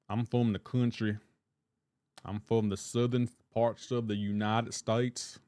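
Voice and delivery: slow imitated Southern drawl; Forrest Gump. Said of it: "Forrest Gump".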